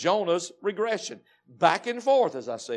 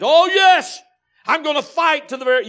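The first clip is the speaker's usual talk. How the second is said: loud, much higher defiant cry; defiant Jonah; 10 semitones higher, 14 dB louder than usual. Acted. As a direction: fling it back at God in defiance. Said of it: "That wrongful spirit seems to fight".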